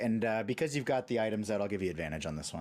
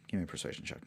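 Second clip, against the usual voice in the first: whispered; 3 semitones lower, 6 dB quieter, much faster than usual. Acted. GM repeats the request, hushed.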